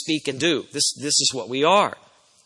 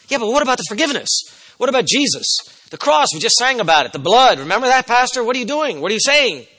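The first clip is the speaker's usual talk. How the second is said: high-pitched, rapid-fire objections; acted-out pushback at the pastor; protesting congregant; 7 semitones higher, 4 dB louder than usual. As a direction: pile up worried questions in a raised voice. Acts out congregation's alarmed objections.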